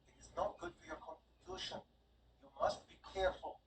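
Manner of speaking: mother-hen her mistress; fussing handmaid. Acted. Handmaid scolding the baroness over whiskey, high and fussing.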